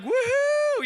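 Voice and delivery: high drawn-out "woohoo"; celebratory whoop. Retold or imitated. Ahab hears the rain is coming, celebratory whoop. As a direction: let out a long, high whoop. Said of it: "Ahab's like".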